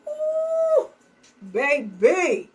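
put-on high swooping "Ooh, baby" of admiration; admiring ooh. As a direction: gush like an impressed onlooker. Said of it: "They should be saying".

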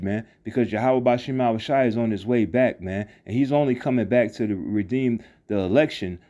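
normal talking voice

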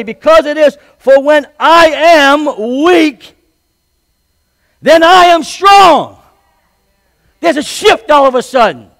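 high, loud, slow climactic preaching; a pastor's high, loud peak; preaching at full force. Acted. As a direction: preach it out high and loud, stretching every phrase.

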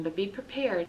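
plain reading voice

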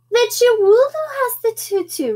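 high-pitched little sister voice; piping kid's reply; little girl. Acted. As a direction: pipe up high and loud like a small child. Little sister answers her mother.

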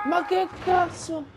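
high, loud startled outburst; drawn-out, high-pitched shock. Real, not acted. Player jolted by a sudden scare in a horror game, startled.

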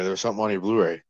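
usual speaking voice

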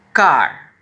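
"car" said as English "kar"; model English word. Shown as a demonstration. Teacher modeling "car" for kids to repeat, loud. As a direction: say "car" clearly and loudly. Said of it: "diga otra vez".

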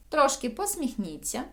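usual speaking voice

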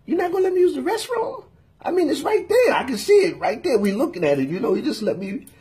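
high-pitched, acted-out protest; incredulous plea. Customer retelling his plea to use the restroom, incredulous. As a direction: plead your case in a high, disbelieving voice.

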